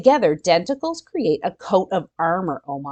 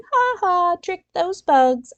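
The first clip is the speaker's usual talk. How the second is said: mock gloating laugh; 11 semitones higher than usual. Gloating at the bugs.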